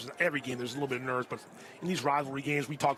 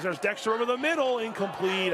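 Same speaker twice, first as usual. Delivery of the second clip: excited play-by-play; 6 semitones higher than usual. Real, not acted. Announcer calls a pass for listeners, excited play-by-play. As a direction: pitch jumps up on the pass call.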